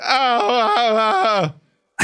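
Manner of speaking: high, hammed-up sobs; wailing pretend sobs; mock sobbing. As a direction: fake a miserable cry. Acts out crying while eating.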